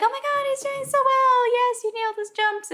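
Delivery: very high-pitched gushing commentator; mock excited praise. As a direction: imitate thrilled commentators, pitch way up. Acting out delighted skating commentators.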